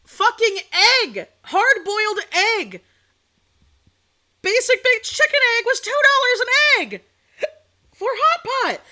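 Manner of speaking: 1 outraged rant. Real, not acted. Ranting about egg price.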